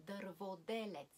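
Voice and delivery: ordinary talk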